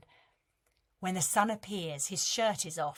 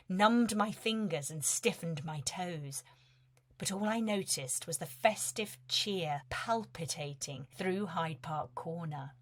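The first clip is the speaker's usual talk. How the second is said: plain reading voice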